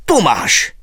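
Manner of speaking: ordinary delivery, nothing marked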